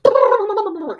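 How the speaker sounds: a sleepy partner's feeble laugh, mimicked; groggy mock laugh. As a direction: mimic his half-awake laugh. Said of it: "And he goes".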